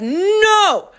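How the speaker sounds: high, loud, drawn-out shout; stubborn refusal. A narrator's reading. Father refusing to pay the cashier, stubborn shout.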